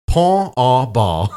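slow, drawn-out French "Pont au Bal"; mock French pronunciation. Shown as a demonstration. Host guessing at the French name for fun, mock French pronunciation. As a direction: stretch "Pont au Bal" in French style, final consonants softened away. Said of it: "that's probably how it is in French".

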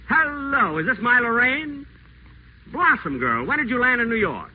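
high, gushing sweet talk; butter her up on the phone; honeyed phone charm. Acted. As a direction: pitch the voice up and coo over the phone line. Whiteside sweet-talks Lorraine on phone.

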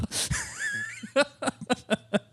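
high burst of laughter. Real, not acted. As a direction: let out a big high laugh. Laughs at own joke.

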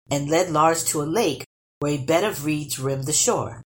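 plain reading voice

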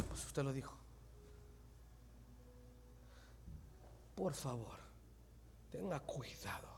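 a soft, breathy whisper of caution; hushed warning. Acted. Preacher quietly warns the congregation about careless words.

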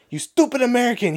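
high, pinched mock French accent; French kid cussing out the drunk American; angry French kid. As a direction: play the furious French kid. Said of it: "the little French kid goes".

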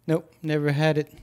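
ordinary delivery

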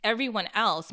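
ordinary delivery

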